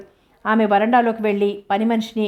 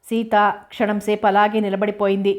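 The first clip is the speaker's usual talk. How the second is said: ordinary delivery, nothing marked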